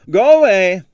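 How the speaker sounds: high, loud "go away" brush-off; loud dismissal. Acted. Impresario shooing off a pest, loud and high. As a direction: shoo him off loudly.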